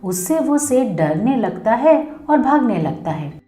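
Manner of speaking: usual speaking voice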